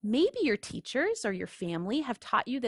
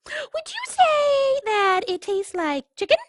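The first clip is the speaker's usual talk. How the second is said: much higher little duckling voice; innocent duckling; 6 dB louder than usual. Acted. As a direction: ask sweetly, almost baiting.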